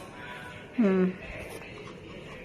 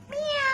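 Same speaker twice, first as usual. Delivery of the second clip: high little mock meow; a quick kitten-like meow; imitated cat meow; 4 dB quieter than usual. Owner meowing softly at the kitten, high imitated meow.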